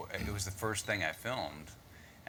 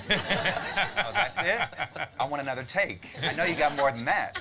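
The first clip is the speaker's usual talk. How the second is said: put-on taunting kid; 7 semitones higher, 7 dB louder than usual. Mocking kid demanding another take.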